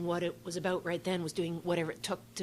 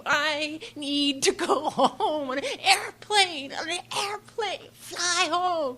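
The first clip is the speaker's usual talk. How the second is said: pleading to a cab driver; 9 semitones higher, 6 dB louder, much slower than usual. Retold or imitated. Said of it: "So I was crying".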